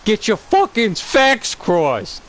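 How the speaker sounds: high, slow mock tough cop; squeaky slow cop impression; mimicking Bullock. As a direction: drawl it out like a gruff old detective. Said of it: "it's the same guy where he's like".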